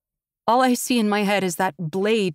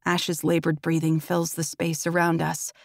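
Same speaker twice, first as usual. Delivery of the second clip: plain reading voice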